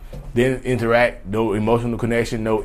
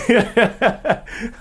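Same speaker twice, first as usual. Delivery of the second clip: short high laugh; 13 semitones higher than usual. Real, not acted. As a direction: laugh high at your own joke. Laughing at himself.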